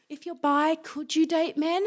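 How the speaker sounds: retold grandmother's careful question; imitating nan. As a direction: play a tentative nan asking slowly. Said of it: "my nan was kinda like".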